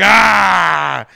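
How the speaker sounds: loud, high anguished cry; anguished shout. Acted. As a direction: let out a heartbroken yell. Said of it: "I kinda wanna say, like".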